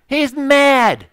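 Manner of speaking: high, loud exclamation; exclaimed. Real, not acted. Preacher telling congregation Jonah's anger, loud. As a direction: hammer home his outrage.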